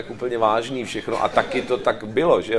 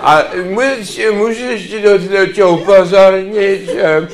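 put-on higher, slow, stumbling drunk voice; opilý herec; 4 semitones higher, 4 dB louder, slower than usual. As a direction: play a tipsy actor struggling through an apology. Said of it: "že herci pijou". (opilecky se zakoktávat)

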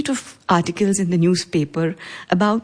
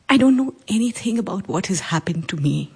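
ordinary talk, nothing marked

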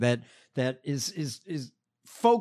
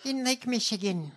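caricatured candidate; 7 semitones higher than usual. Retold or imitated. Co-host parodying the candidate's constituent story, hammed-up caricature. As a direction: do her anecdote as an overdone sketch character. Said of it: "If Lily Tomlin would have done this".

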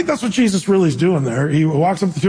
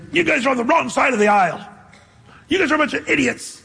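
higher-pitched mock telling-off; mock scolding; 7 semitones higher, faster than usual. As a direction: imitate someone berating a crowd. Acts out scornful name-calling at people.

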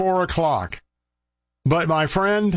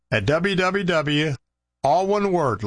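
normal talking voice

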